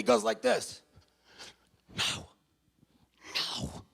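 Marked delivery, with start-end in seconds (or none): 2.0-3.8 soft whispered "no, no"; hushed no. Retold or imitated. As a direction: shake it off quietly. Matt waving off, pointing.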